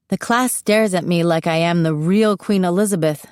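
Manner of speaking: ordinary delivery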